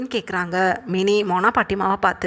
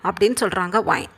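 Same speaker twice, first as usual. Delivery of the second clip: plain reading voice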